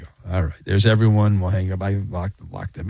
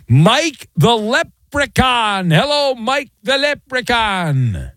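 slow, high, showy welcome; high, slow announcer-style hello; drawn-out greeting; 6 semitones higher, much slower than usual. Real, not acted. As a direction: stretch the name out like a game-show host. Host greets caller with drawn-out flourish.

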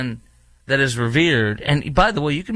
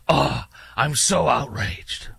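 holier-than-thou; 2 semitones higher, slower than usual. Acted. Host mocking people who act holier than thou, mock-outraged. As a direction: gasp it slowly in exaggerated, pious indignation. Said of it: "talk like I'm, uh, holier than thou".

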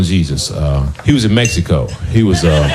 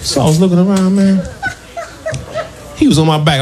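laughing; faster than usual. Retold or imitated. High laughing storytelling.

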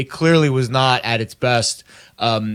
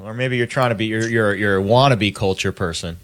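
ordinary talk, nothing marked

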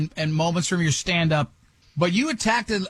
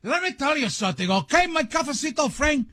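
put-on a lightly Spanish-accented, higher "cafecito friend"; Cuban Spanish accent; 6 semitones higher than usual. Host jokingly catching the comedian's accent mid-argument. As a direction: slip into a Miami Cuban accent. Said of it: "I fell right into the accent".

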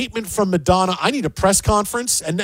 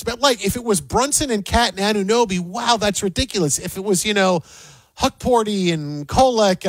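normal talking voice

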